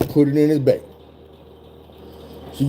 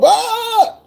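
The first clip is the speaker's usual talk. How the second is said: short, very high yelp; imitated scream of pain. Man being tased by officer, high pained yelp. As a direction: cry out like the shock just hit.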